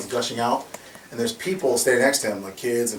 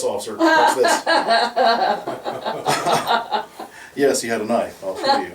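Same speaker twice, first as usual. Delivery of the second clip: higher voice breaking into laughter; laughing through it; 5 semitones higher than usual. Real, not acted. Storyteller cracking up while quoting himself to police, laughing. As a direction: try to say it but the laugh wins.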